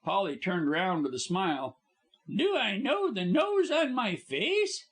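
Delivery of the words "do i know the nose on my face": high, airy and light; light actress voice. Acted. Actress Polly answers the visitors lightly. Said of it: "she said lightly".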